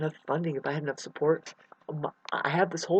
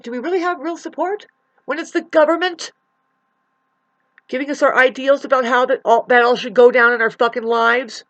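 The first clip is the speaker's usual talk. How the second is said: rising, louder and higher, fired up; heated rant; 7 semitones higher, 9 dB louder than usual. Real, not acted. Railing against the government, loud heated rant. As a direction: let the outrage build and burst out. Ranting about government support.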